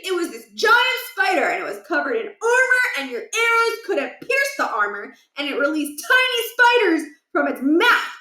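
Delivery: put-on high, rushing boyish voice full of wonder; a kid breathlessly telling about a video game; excited kid Stuart. Stuart gushing to friends about a game boss, high and excited.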